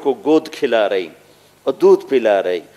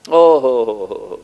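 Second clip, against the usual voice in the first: imitated blast sound. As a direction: hum it like a distant trumpet.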